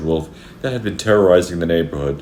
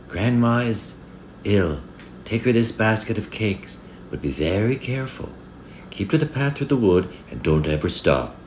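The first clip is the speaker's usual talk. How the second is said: higher-pitched motherly voice, careful instructions; mummy's higher-voiced warning; mother's warning; 7 semitones higher than usual. A narrator's reading. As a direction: caution a child as her mummy. Mother warns child in story.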